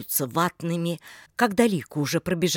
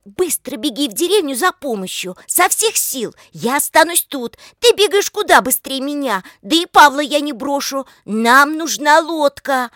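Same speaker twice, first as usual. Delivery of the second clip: high, loud, pressing voice; urgent orders; 8 semitones higher, 8 dB louder than usual. A narrator's reading. Lika sending Oleg for help, loud urgent orders. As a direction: send him running with raised, urgent commands.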